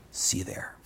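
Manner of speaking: whispered, secretive; conspiratorial whisper. Acted. Prince sets the staged rescue with his officers, in a whisper. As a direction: hush it like a secret plan.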